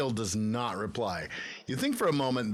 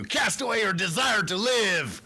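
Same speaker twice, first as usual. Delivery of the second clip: dramatic command; 7 semitones higher, 5 dB louder than usual. Acted.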